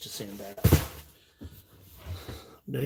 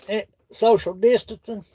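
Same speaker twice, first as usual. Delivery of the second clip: ordinary delivery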